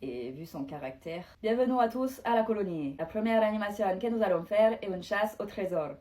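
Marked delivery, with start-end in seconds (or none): 1.4-5.9 host's announcement. Acted. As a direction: announce the game to everyone. Host welcomes the colony, announces game.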